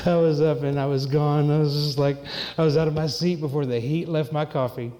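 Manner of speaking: normal talking voice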